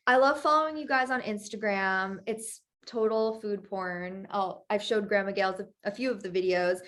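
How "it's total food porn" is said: ordinary talk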